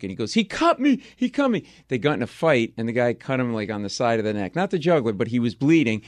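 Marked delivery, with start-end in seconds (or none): 0.3-1.6 very high, mock-drunk yelp; drunk man's cry. Comic voicing drunk bar patron, high mock cry. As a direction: wail it like a drunk who's hurt. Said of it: "He's drunk".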